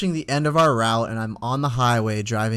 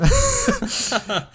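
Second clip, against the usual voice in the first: squealing, disbelieving laugh; high laugh. Real, not acted. Laughing at the boss's indifference.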